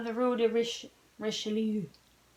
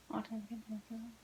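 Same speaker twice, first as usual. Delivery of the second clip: a soft whispered repeat, much softer; 2 semitones lower, 18 dB quieter than usual. Real, not acted. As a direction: breathe the line back to yourself. Reader repeats line under breath.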